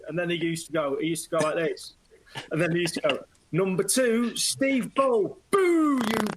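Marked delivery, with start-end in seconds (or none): 3.5-6.3 high mock announcer call sliding into a boo; hammed-up announcer turning to booing crowd; mock announcement and boo. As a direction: announce the player, then jeer him.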